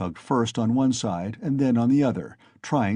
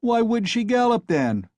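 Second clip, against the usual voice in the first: mocking jeer; 12 semitones higher, 3 dB louder than usual. A narrator's reading. Crowd mocking Mikolka.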